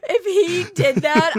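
loud, high, talking through laughter; laughing hard. Real, not acted. Listener cracking up over the imagined cheesy line, helpless laughter. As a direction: barely get it out for laughing.

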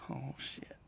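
slow, whispered realization; retold under-the-breath curse; hushed dread. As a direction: whisper it as if frozen. Finds the dryer door open.

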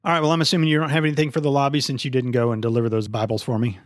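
ordinary talk, nothing marked

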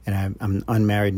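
normal talking voice

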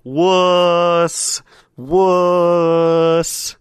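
high, drawn-out taunting shout; yell across the room. Acted. Player heckles a character from across the room, taunting yell. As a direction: holler it as if across a crowded pub. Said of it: "from across the room, I'll yell".

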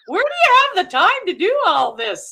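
high, loud mock-incredulous question; exaggerated, high-pitched disbelief; imitating everybody's amazement. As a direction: exclaim it like astonished onlookers. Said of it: "Everybody goes".

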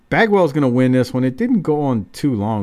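ordinary delivery

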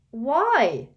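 loud, high indignant yelp; exclaim. Acted. Ron exclaims at his mother, loud and high. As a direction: burst out in protest. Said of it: "exclaimed Ron".

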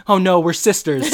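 high, loud, put-on squeal; mock shriek. Sean shrieking at the date with mock horror. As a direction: shriek like a scandalised sibling. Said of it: "And Sean goes".

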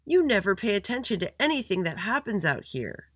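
high-pitched accusing shout. A narrator's reading. Maya accuses the recess monitor, shouting. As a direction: shout the complaint at him. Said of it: "shouted Maya".

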